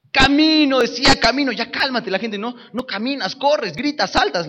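high, joyful retold shouts; excited. A narrator's reading. Preacher voicing the healed man and crowd, excited. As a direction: bounce between the man and the crowd.

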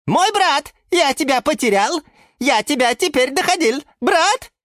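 high, loud excited little plant; Sushil character voice. A narrator's reading. Sushil greets his found brother, high and excited. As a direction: cry out with joy at finding family.

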